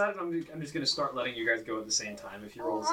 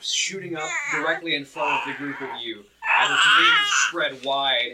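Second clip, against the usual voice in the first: dramatic build-up of the monster's attack; game master narration; 3 semitones higher, 6 dB louder than usual. Acted. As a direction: narrate the dragon rising, building in force. Game master describes dragon.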